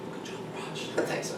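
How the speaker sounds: soft whispered pat on the back; whispered self-praise. Shown as a demonstration. Coach whispers praise to himself in his head, hushed. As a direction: whisper it like your own biggest fan. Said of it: "let me speak as my biggest fan".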